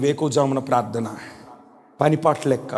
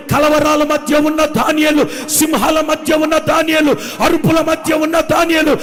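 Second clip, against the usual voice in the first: loud, high fervent preaching cry; preaching at full force; 9 semitones higher, 5 dB louder than usual. Acted. Preacher to his congregation, shouting with full fervour. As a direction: rouse the congregation with rising shouts. Preaching Daniel story loudly.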